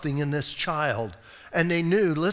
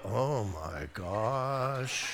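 doting parent; 7 semitones lower, 8 dB quieter, much slower than usual. Retold or imitated. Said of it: "look at their kid and go".

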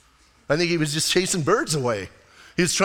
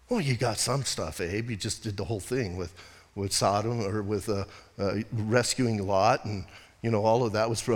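gentle God; 9 semitones lower, 7 dB quieter than usual. Acted. God answering sulking Abraham.

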